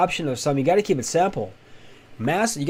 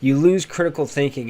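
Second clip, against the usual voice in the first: usual speaking voice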